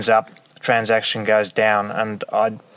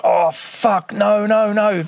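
panicked; 10 semitones higher, 3 dB louder, slower than usual. Retold or imitated. Panicking as police approach.

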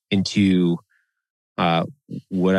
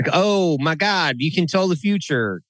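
mock amazement; 7 semitones higher than usual. Sceptic mimicking an awed witch doctor customer, mock amazement. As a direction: gush in higher, exaggerated wonder.

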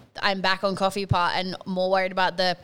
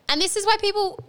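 ordinary delivery

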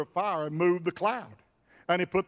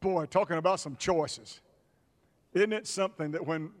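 normal talking voice

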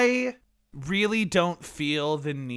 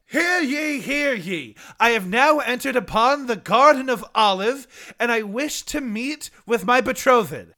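town crier; 6 semitones higher than usual. Acted. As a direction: proclaim it like a medieval herald. Medieval suitor announcing himself.

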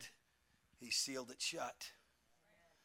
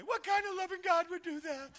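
high, squeaky mock-outraged voice; a much higher, whiny protest; mocking the world; 6 dB louder than usual. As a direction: ham up a scoffer flailing his arms. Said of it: "That's how silly it looked".

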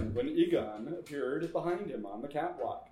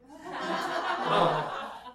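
triumphant cackle; 3 semitones higher than usual. Acted. Laugh as lights brighten.

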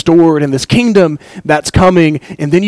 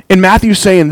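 ordinary talk

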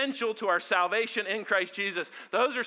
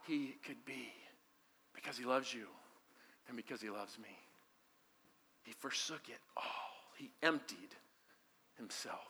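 low, soft, near whisper; tender hush; 8 semitones lower, 15 dB quieter than usual. Real, not acted. Preacher tells the church God loves them, tenderly. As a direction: say it gently, tenderly.

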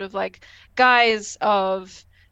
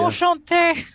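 high, charmed French greeting; Minuet speaking French; 8 semitones higher, 4 dB louder, slower than usual. Acted. Minuet greeting Picard, high playful French. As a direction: put on a high, flirty French voice. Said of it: "then she speaks French".

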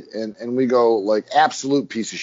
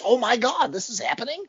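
high, awed gasp of surprise; hammed-up wonder at a twist; mock amazed; 11 semitones higher than usual. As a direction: play a stunned first-time viewer. Acting out first-time viewer awe.

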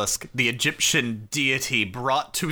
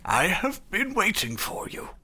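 put-on slow, mysterious old man; lightly voiced wise elder; 4 semitones higher, slower than usual. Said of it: "old man standing outside the museum like".

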